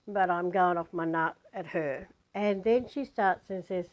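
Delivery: normal talking voice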